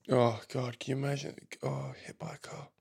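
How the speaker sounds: soft whispered horror; whispered dismay. Real, not acted. Reacting to a hurt dog in the post, a hushed whisper. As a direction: breathe it out under your breath, stunned.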